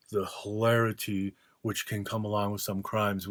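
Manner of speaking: normal talking voice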